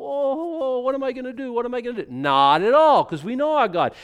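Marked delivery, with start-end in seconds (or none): 0.0-2.1 higher, hammed-up worried voice; mock God wringing his hands in panic; mock fretting God. Preacher mocking the idea of God stressed by rebels, higher. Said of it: "he's all".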